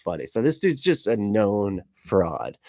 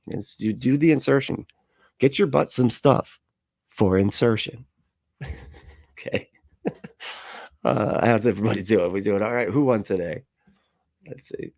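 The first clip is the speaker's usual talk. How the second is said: ordinary talk, nothing marked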